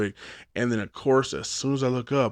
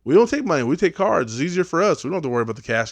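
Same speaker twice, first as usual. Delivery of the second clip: put-on high, louder kid pitch; little girl voice; 5 semitones higher, 5 dB louder, much faster than usual. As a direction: sell it like a cheerful kid. Girl scouts retold.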